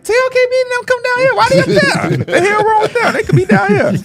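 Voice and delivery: very high mocking big-shot voice; snobby big shot. Imagined big shot on the floor, mocking, very high. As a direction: play the star acting superior. Voicing a floor-seat star.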